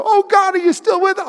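high, pleading cry to God; mock-anxious people crying out to God; anxious Israelites calling. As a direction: plead upward like a worried crowd. Preacher voices anxious Israelites.

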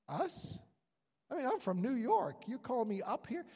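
who, me? up there?; incredulous surprise. Retold or imitated. Incredulous at being called.

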